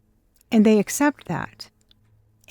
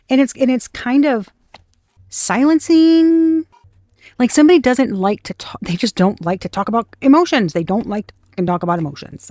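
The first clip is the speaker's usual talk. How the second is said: ordinary delivery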